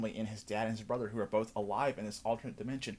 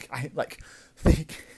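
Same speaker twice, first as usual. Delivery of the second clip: laughing through the words; words dissolving into real laughter; a real laugh breaking into the words; real breathy laugh; 3 semitones higher, 8 dB louder, much slower than usual. Real, not acted. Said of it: "I cannot stop laughing".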